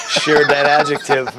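laughing through the last words. Real, not acted. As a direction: breaks into a laugh mid-sentence. Laughing about the word wicked.

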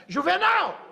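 high, loud shout of the name. Retold or imitated. The farm owner shouts for his foreman at the inspector, loud. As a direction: yell for Juvenal.

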